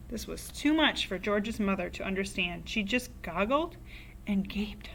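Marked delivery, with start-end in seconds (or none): none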